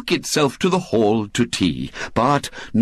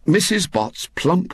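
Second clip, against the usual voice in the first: usual speaking voice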